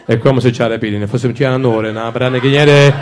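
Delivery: mock Italian lover, pouring out gibberish; Italian seducer.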